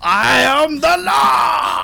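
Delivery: booming, slow tyrant's proclamation; roaring tyrant king. Acted. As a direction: roar it like a mad dictator. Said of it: "Oh my God, calm down".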